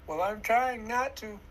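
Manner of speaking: mock-proud quote of holding back tears; mocking imitation. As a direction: parrot the brave excuse. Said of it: "we have taken in the programming".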